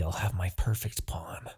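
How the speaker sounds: soft, breathy scheming aside; gloating whisper. Acted. Demon king whispering his gloating plan for his new pawn.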